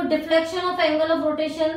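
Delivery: very slow, word by word, so students can write; slow dictation. Real, not acted. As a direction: dictate each phrase slowly and clearly. Teacher dictates notes to write. (धीरे-धीरे लिखवाना)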